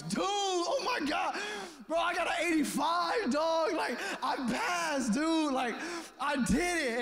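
a buddy bragging about his passing grade; excited. Retold or imitated. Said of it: "And Brett's excited".

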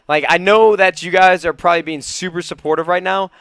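ordinary delivery

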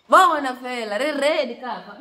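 loud outburst. Real, not acted. Hot food reaction outburst.

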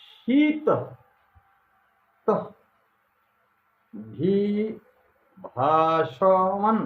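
mantra spoken syllable by syllable, long pauses between; slow, deliberate chant-like dictation of each sound; very slow recitation. Shown as a demonstration. Guru dictating the mantra to followers, very slow and syllable by syllable. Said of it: "মন্ত্রটি আমি খুব ধীরে ধীরে একবার বলে দিচ্ছি".

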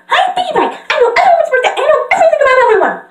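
very high, loud, racing chatter; Pinkie Pie. Acted. Pinkie boasting she knows everyone's birthday, hyper squeal. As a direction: squeal it out and speed up. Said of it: "talking back to myself with random characters' voices".

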